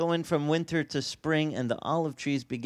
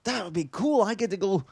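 excited inner voice; 7 semitones higher than usual. Retold or imitated. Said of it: "I'm like".

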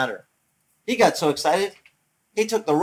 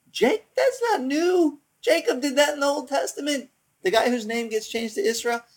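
excited exclamation; 12 semitones higher than usual. Real, not acted. Preacher telling congregation Jacob did this first, excited exclamation. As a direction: pitch it way up, insistent.

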